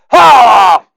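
high loud "Ha!"; shouted ha. Acted. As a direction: bark it out. Shouted catchphrase.

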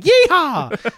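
loud, high cowboy whoop; Texan yeehaw. Retold or imitated. Queenslander overseas mocking himself as a yeehawing Texan. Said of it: "the Australian version of a Texan saying".

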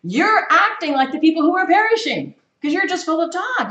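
rising up high and loud in rebuke; fervent preaching. Real, not acted. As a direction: preach it hard, scolding the church.

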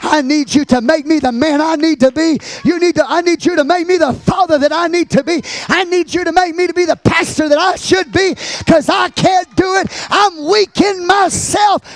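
high, shouted plea to God; desperate, loud prayer of confession; impassioned preached prayer. Real, not acted. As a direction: plead at full pulpit force. Pleads with God while preaching.

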